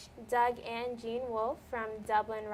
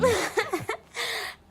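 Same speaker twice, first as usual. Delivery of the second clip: bursts out laughing, high; a real, high giggle at the question; startled high laugh; 11 semitones higher, 4 dB louder than usual. Real, not acted.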